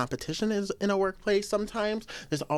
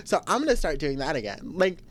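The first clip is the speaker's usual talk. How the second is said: ordinary delivery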